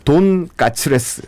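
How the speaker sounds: slow Japanese-style tonkatsuresu; 돈까츠레스 drawn out slowly, Japanese style; Japanese accent korean. Shown as a demonstration. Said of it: "한자로 돼지 돈자를 쓴".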